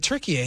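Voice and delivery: "Turkey, eh" as Tur-kee-ay; "Turkey, eh" said as the name Türkiye; announcer's pronunciation. Retold or imitated. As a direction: quote "Turkey, eh" with the extra final syllable. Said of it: "The announcer kept calling it".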